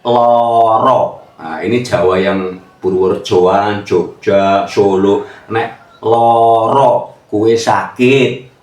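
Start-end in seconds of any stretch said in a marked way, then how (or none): 0.0-1.2 loro said with round o sounds, slow; Javanese loro. Shown as a demonstration. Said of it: "masih ada kalimat". 5.5-7.1 loro drawn out slowly with open o vowels; Purworejo loro. Shown as a demonstration. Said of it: "loro nek wong Purworejo".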